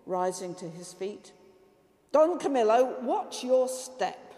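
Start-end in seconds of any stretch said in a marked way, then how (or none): 2.1-4.3 stern warning. A narrator's reading. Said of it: "said the Lord sternly".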